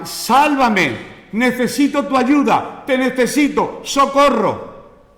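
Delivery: high, pleading cry for help, slowing on socorro; desperate plea. Acted. Preacher voicing the crowd begging Jesus for help, high and pleading. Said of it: "Es lo que estaban diciendo".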